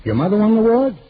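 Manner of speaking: can't believe his mother won; surprised. Real, not acted. [name] surprised by Oscar's news.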